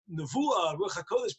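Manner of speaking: ordinary talk, nothing marked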